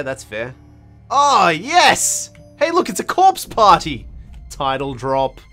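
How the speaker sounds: usual speaking voice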